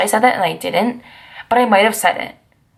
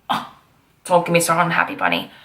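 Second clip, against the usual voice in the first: ordinary delivery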